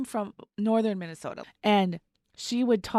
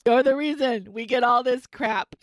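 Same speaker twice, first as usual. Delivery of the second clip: slow, louder, higher mock accusation; mock scolding; 4 semitones higher, 6 dB louder than usual. To coworker with the strong accent, mock scolding. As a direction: lay the blame on them, drawn out.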